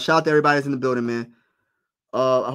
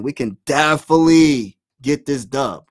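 hype shout; 8 dB louder than usual. Real, not acted. Hyping his team to win.

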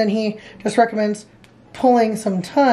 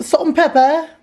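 higher-pitched, put-on British "Salt and pepper"; better British accent; 5 semitones higher than usual. Shown as a demonstration. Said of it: "That's a little better".

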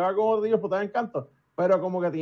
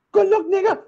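put-on much higher, loud punchline bark of the old man; loud impression; 13 semitones higher, 8 dB louder than usual. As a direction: hit the old man's parting line big. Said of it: "me mira fijamente, y me hace". (imitación del señor, alta y fuerte)

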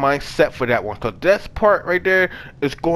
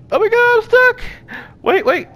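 much higher, louder shriek of I'm stuck; panicked shriek; 5 dB louder than usual. Real, not acted. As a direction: scream it in real panic. Panicked shout, stuck in game.

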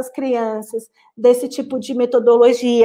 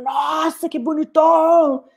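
admiring a handsome man; slower than usual. Shown as a demonstration. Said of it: "olham o homem e falam".

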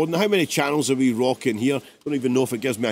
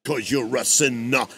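lower, deep narrated vocal; deep house-track voice; 4 semitones lower, 3 dB quieter than usual. Retold or imitated. Producer quoting an old house song, in an imitated deep voice. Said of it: "deep voice that kinda runs through it".

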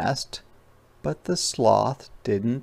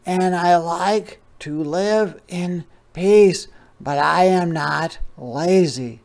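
unhurried sloth; 7 semitones higher, 6 dB louder than usual. Acted. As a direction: talk like a calm sloth in no hurry, much higher. Sloth voiced in read story.

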